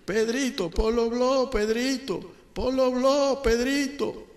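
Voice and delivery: canturreo agudo; llámalo cantando con guasa; llamada burlona. Acted. Cristo llama a Pedro. (llamada cantada y burlona)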